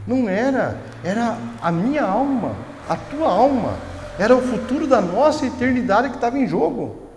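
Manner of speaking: high, impassioned pulpit cry; fervent preaching. Acted. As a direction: preach it high, pleading with the church. Preacher builds to climax. (pregação fervorosa, voz alta e aguda)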